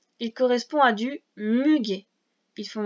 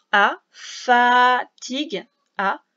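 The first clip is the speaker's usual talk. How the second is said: "fatigue" stretched very slow and louder to isolate the sound; sounding out slowly; 6 dB louder, much slower than usual. Shown as a demonstration. Teacher sounding out a word for a child, very slow and loud. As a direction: draw out "fatigue" so a child hears each sound. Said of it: "Deuxième son".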